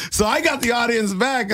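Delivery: ordinary delivery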